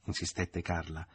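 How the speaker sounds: usual speaking voice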